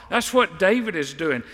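ordinary talk, nothing marked

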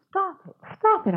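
tearful, pleading cry; crying plea. Acted. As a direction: beg through tears for it to stop. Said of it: "I cried".

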